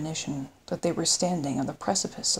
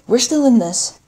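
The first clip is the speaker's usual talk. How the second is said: rallying shout; 6 semitones higher, 10 dB louder than usual. Acted. Officer rallies after the hit.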